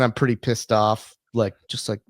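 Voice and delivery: normal talking voice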